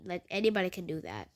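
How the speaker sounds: breathy whisper, quick and sulky; whispered retort. Retold or imitated. Teen sulkily whispering back to the principal. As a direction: mutter it under your breath.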